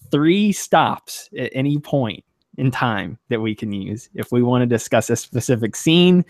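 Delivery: ordinary delivery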